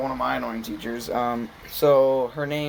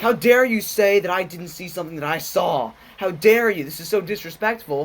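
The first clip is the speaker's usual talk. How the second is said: higher, louder, hammed-up indignant teacher; imitated teacher scolding, pitched up and loud; mock outraged teacher; 5 semitones higher, 4 dB louder than usual. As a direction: act out the teacher's outrage at the students.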